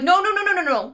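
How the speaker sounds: high, loud string of noes; hasty mock reassurance. God correcting a panicking Adam, hurriedly and high. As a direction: cut in to stop the panic.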